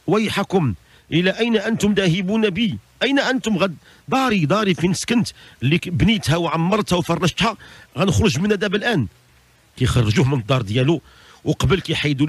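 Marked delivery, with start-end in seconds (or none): 0.1-9.1 high, acted cry of protest. Dead man to his bearers taking him from home, crying out. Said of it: "يصيح".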